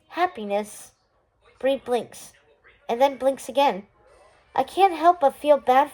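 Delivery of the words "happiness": soft, sad, quieter; a sad smile in the word. Acted. Shazne answering Bree softly and sadly. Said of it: "Shazne smiles sadly".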